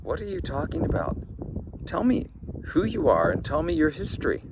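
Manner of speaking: put-on a startled old fisherman demanding answers; lightly voiced, puzzled old man; baffled old man.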